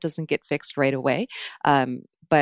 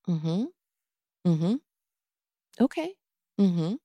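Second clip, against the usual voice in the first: just hum along without engaging; noncommittal murmurs; 2 semitones higher, slower than usual. Shown as a demonstration. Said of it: "my answer is".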